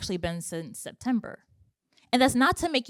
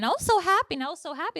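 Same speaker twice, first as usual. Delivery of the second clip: excited; 8 semitones higher, faster than usual. Retold or imitated. Recalling joy at content launch.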